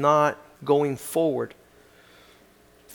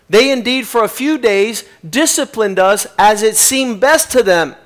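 louder preaching, higher than his opening talk; 6 semitones higher, 10 dB louder than usual. Acted. Preacher turns from the letter to the congregation, preaching. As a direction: drive it home to the congregation.

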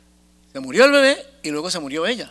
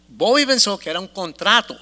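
ordinary talk, nothing marked

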